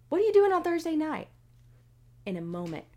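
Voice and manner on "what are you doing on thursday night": fast, loud, high question; excited host. Retold or imitated. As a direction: fire off the winner question. Radio host announces the win.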